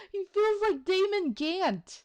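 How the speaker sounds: high, loud burst of recognition; excited outburst. Real, not acted. Player spotting a lookalike character, excited outburst.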